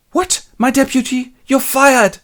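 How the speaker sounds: high, loud, hammed-up fury; shouted comic dismissal; outraged boss. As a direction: explode in disbelief, then sack him. Boss furiously fires deputy.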